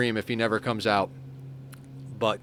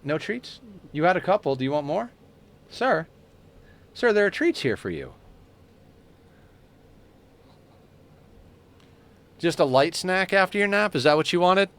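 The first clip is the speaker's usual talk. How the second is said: much higher, sing-song coaxing of a kitty; high pet talk; 6 semitones higher than usual. Acted. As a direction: lure the cat to the treats in your hand. Coaxing cat with treats.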